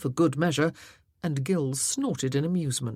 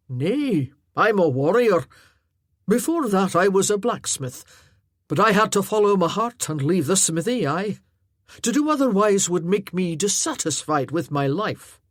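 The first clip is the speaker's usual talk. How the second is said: a big, earnest warrior, louder and sincere; lightly voiced Highland warrior, heartfelt; 3 semitones higher, 7 dB louder than usual. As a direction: speak plainly and earnestly to the lass. Arter explains himself.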